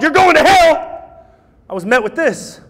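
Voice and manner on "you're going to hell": mock condemnation. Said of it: "Instead of being told".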